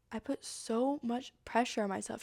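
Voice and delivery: ordinary delivery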